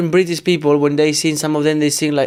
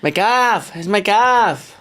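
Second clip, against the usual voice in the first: "calf" with a long English a, pitched up and slow; mock English accent; 5 semitones higher, much slower than usual. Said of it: "Can you say".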